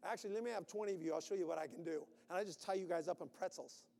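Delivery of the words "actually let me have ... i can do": ordinary delivery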